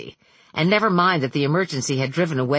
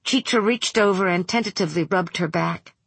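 ordinary delivery, nothing marked

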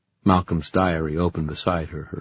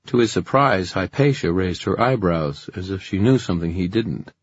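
usual speaking voice

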